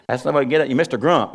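usual speaking voice

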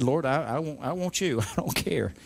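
soft, low plea; hushed, halting appeal to God; retold surrender prayer. New convert giving himself to the Lord, softly pleading.

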